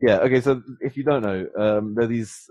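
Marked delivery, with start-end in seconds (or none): none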